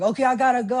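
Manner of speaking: loud, high hurried goodbye shout; friend calling out. Retold or imitated. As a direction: imitate a friend hollering from a car. Friend calls goodbye from car.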